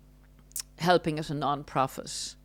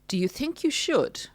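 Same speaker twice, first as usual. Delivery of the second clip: condescending narcissist; 7 semitones higher than usual. Retold or imitated. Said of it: "Implying it's not a good decision".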